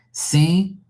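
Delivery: a hint of someone picking up the phone; phone answer. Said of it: "when somebody answers the phone".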